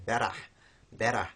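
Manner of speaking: Australian BERAH, flapped middle consonant; Australian English. Shown as a demonstration. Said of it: "also in Australian English".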